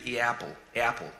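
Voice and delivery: first vowel of "apple" split into two, starting from an E; diphthong A. Shown as a demonstration. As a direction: glide from E into the A of "apple". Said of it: "it sounds like two".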